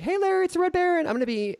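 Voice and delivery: usual speaking voice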